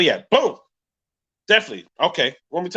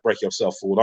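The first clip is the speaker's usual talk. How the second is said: mock stickup; 8 semitones lower, 5 dB quieter than usual. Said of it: "I don't mean roll up on me like".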